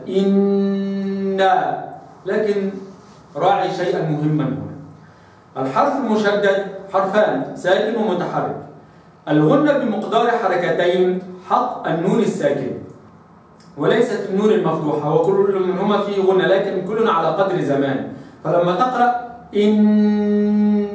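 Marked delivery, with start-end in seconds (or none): 0.1-1.4 إن held very long with a nasal hum on the noon; two-beat ghunna. Shown as a demonstration. Teacher recitation showing the full ghunna. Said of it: "فأقرأها هكذا". 19.5-20.9 إن held very long, nasal hum on the still noon; nasal ghunna. Shown as a demonstration. Teacher showing the ghunna on the still noon. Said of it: "النون الساكنة هي المغنى".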